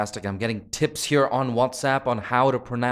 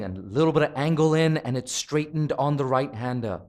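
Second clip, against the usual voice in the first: ordinary delivery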